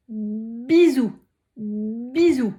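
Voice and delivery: the word bisou sounded out clearly, teacher style; articulé lentement. Shown as a demonstration. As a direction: stretch out bisou with a crisp B. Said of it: "J'avais trouvé le mot". (bisou bien articulé)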